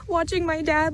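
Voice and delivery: ordinary delivery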